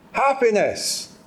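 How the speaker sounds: imitating an audience's happy read-out; mock cheerful chorus. Speaker echoing how the audience read out happiness, cheerfully. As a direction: say it upbeat, lifting high then falling. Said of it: "read those two words out entirely differently".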